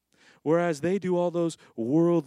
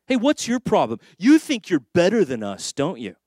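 acted challenge, louder and higher; confrontational coworker; 3 semitones higher, 6 dB louder than usual. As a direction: go at them as an offended colleague.

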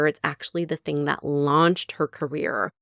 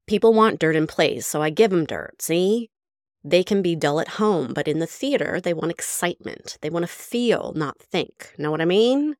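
put-on full Mae West accent, louder, with a sly "see?"; 3 dB louder than usual. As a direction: read it as brassy Mae West, loud and teasing. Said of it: "I have to read it in the Mae West accent".

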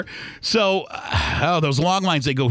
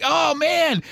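mock wince; 10 semitones higher than usual. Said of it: "You're like".

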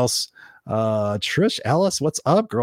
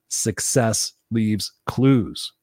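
very slow, word by word; spaced-out, deliberate delivery of a maxim; emphatic slogan; much slower than usual. Real, not acted. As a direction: let each word land separately.

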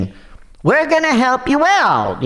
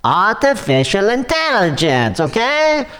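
put-on light Russian accent, defensive; offended caller with a Russian accent. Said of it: "you sound pretty Russian to me".

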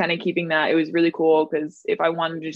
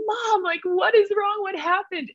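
high, alarmed pleading to mom; retold panic. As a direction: recreate the panic of thinking someone died. Panicked daughter seeing mom cry.

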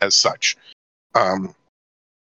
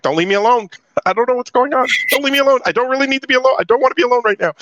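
retold panic; 12 semitones higher, faster than usual. New inmate begs his cellmate not to leave, in retold panic. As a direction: relive begging him not to go, pitch climbing high. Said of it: "I remember panicking 'cause I'm like".

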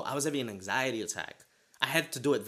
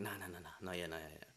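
hushed inner voice; 4 semitones lower, 14 dB quieter, faster than usual. Retold or imitated. Inner voice holding back.

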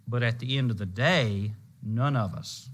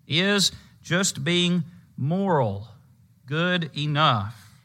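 slow emphatic question; 4 semitones higher, much slower than usual. Acted. Preacher slows the key question.

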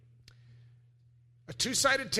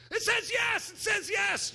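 much higher shouted yes; excited shout; slower than usual. Retold or imitated. Preacher voices the neighbour shouting his yes ticket. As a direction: yell it out in glee.

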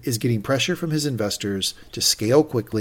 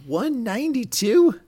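much higher, slow, rising question; stunned disbelief; 11 semitones higher, slower than usual. Real, not acted. Reacting to the surprise offer with a co-host, stunned. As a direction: ask it like you can't believe it.

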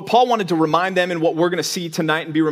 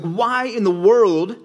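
incredulous Paul; 5 semitones higher than usual. Retold or imitated. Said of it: "he's like".

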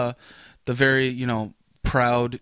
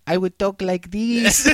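slow, higher, hammed-up Mexican accent; exaggerated Mexican accent; 5 semitones higher, slower than usual. Teasing a Mexican coworker, hammed-up Mexican accent. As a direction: lay on a mock Mexican accent, drawn out. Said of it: "if I was Mexican".

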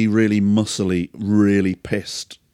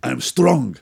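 higher, louder mock Eastern European bravado; drunk Eastern European boast; 9 semitones higher, 4 dB louder than usual. Drunk neighbour boasting to the narrator that they are strong, in a hammed-up Eastern European accent. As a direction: ham up a drunk bodybuilder.

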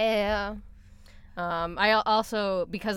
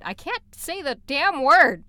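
loud, high, drawn-out frustration; exasperated outburst; 7 semitones higher, 11 dB louder, slower than usual. Real, not acted. Host stumbling over a game name, exasperated outburst. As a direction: burst out at your own tongue-tie.

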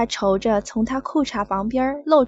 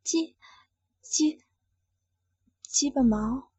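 softer, faltering halting words; embarrassed, forcing it out haltingly; small voice; 3 semitones higher, 4 dB quieter, slower than usual. Acted. Woman forced to say the word, shy faltering murmur. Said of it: "只好小声说道".

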